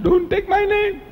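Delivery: high, stretched-out cry; inward shout re-enacted. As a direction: cry it out as a drawn-out protest. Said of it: "No, I didn't shout".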